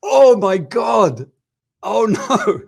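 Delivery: a stunned oh my God; horrified gasp. Retold or imitated. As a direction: relive the shock of the blunder. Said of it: "And I was like".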